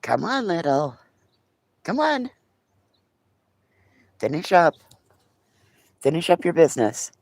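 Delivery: high, coaxing call to the pet; high coaxing voice urging the pet to finish its business. Real, not acted. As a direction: sweetly hurry the dog along.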